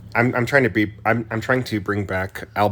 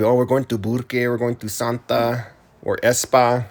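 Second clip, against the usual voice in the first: put-on northern New Mexico accent; 3 semitones higher than usual. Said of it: "accent you're putting on right now".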